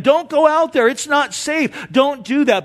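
high, pleading warning from the riverbank; begging. Retold or imitated. Tribesmen begging their friend to stay out of the river, pleading. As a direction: beg him not to go in. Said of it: "tribesmen were begging their new friend".

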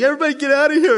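high, loud, hammed-up wail; mock weeping outburst. Joseph clearing the room, mock-tearful outburst. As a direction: cry out as if breaking down. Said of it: "he just starts weeping".

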